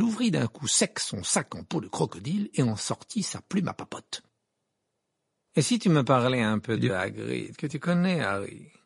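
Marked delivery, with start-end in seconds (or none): 5.5-8.7 affected, insinuating reporter; coaxing gossip journalist. Acted. Rita presses Harry about Hagrid, coaxing. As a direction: wheedle an interview out of them.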